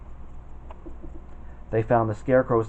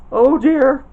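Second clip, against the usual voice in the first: shivering fright; 12 semitones higher, 9 dB louder than usual. Acted. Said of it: "the Cowardly Lion shivered".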